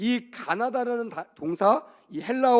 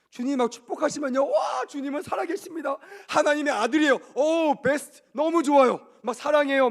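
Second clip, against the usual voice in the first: high, loud, hammed-up adoration; gushing praise; 7 semitones higher, 5 dB louder than usual. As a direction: gush like an over-thrilled believer. Acts out believer thrilled by blessings. (과장되게 들뜬 찬양)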